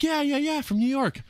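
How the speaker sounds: high, bright eager guy; eager high imitation. Hank eagerly confirming he's from New York, high and eager. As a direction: pitch it way up, overeager. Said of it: "And he's like".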